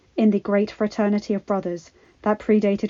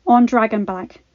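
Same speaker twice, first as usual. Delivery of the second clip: ordinary delivery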